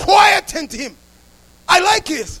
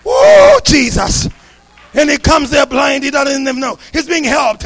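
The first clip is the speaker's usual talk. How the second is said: normal talking voice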